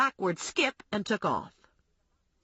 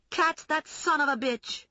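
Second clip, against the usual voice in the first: shouting; 8 semitones higher than usual. Acted. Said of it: "Mary Lou shouted at me".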